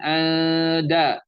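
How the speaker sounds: "ada" as slow "an-da" with hidden N; nakis ikhfa. Shown as a demonstration. Teacher showing nakis, "ada" with long blurred N. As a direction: stretch "ada" on one note, nasal blurred first syllable. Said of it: "itu yang nakis".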